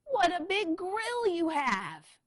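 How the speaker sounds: high, loud, slow exclamation; exclaiming. Acted. Little Red continues amazed.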